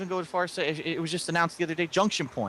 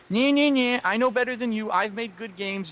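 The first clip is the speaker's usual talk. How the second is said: higher-pitched mocking know-it-all retort; childish refusal to listen; 5 semitones higher than usual. Critic imitates developer with fingers in his ears, mock defiant. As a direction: imitate a stubborn kid blocking out criticism. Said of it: "he put his fingers in his ears and said".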